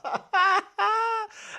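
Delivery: squealing laugh fit; laughing out of control; high laughter. Real, not acted. (rire aigu incontrôlable)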